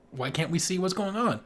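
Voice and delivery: ordinary talk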